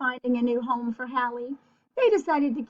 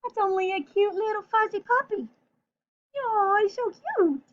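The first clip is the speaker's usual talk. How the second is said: higher, sweet cooing over a puppy; cooing burglar; 8 semitones higher than usual. Acted. Burglar coos at the fuzzy puppy, higher and sweet. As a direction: gush like a burglar charmed by a pet. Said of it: "whispered the other burglar".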